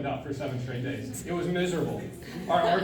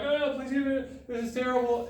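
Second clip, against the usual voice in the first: mock crying whine; 9 semitones higher than usual. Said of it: "I'm like".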